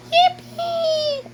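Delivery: very high, drawn-out squeal; attempted accent. Copying a short quote, high squealed cheer. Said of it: "I can't really copy the accent".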